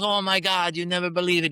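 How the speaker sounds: put-on high, loud, excited Italian old man. The narrator imitating Romeo about business, excited Italian. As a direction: ham up the disbelief. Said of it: "He goes".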